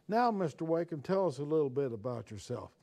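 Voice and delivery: lightly voiced Southern nun with a Virginian drawl calling a new boy up. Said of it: "she had this Virginian drawl".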